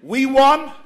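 ordinary talk

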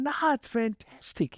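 high, slow, beaming insistence; cheery kid. Retold or imitated. The boy insisting to Paul that today is fantastic, high and slow. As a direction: stretch it out high and happy.

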